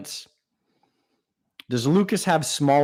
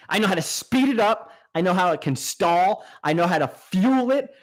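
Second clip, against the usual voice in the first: ordinary delivery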